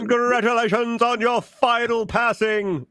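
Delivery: high put-on congratulatory voice; mock congratulations. Congratulating a niece on her finals, mock-grand. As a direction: proclaim it in a silly high voice.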